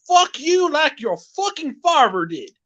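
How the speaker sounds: crude catcall in a hammed-up Southern accent. Southern man catcalling a woman, high Dallas-style twang. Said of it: "That's more Southern, and that actually sounds like Dallas".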